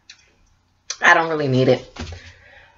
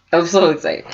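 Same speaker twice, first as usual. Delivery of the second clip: normal talking voice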